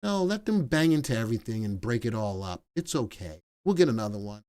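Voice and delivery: gently permissive, quietly waving it off; indulgent wife. Retold or imitated. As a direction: play the easygoing grandma, hushed. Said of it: "And my wife is like".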